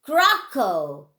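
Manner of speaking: ordinary delivery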